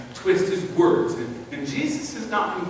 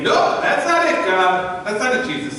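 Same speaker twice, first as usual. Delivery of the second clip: raised, louder objection; acted indignant protest; alarmed protest; 5 semitones higher, 5 dB louder than usual. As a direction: blurt out a refusal, pitch jumping. Acts Peter protesting Jesus's prediction.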